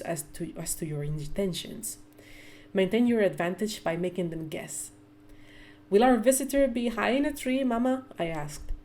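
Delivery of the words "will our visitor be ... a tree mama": put-on higher, eager little girl asking; a curious young girl, lightly voiced; child's voice. Child Elise asks her mother.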